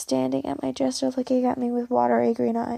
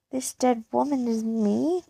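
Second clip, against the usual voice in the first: ordinary delivery, nothing marked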